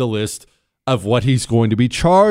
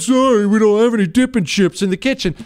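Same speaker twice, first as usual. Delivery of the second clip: mock Michael; 6 semitones higher than usual. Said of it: "He said".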